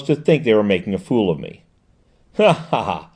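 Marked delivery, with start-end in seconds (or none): 2.4-3.1 a lightly voiced merry laugh; hearty laugh. Dyer to Loveday, mild merry laugh. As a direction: Dyer chuckling at the gang's folly. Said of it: "made the office ring again with his merriment".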